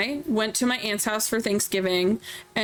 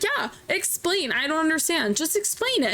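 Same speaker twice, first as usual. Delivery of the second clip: mock innocent; 5 semitones higher than usual. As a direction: play dumb and keep pushing her to explain. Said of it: "And I'm like".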